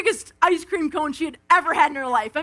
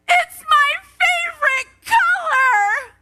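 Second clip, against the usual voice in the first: much higher, louder, slower squeal of delight; pumped child squeal; 13 semitones higher, 5 dB louder, slower than usual. Retold or imitated. Aunt acts out her niece squealing with delight over the rainbow. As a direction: shriek it like a thrilled little girl.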